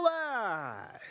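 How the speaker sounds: high, drawn-out announcer cry; celebratory call-out. Pretend. Teacher awarding a badge to a reciter, high celebratory call. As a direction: sing out the award, stretching each word.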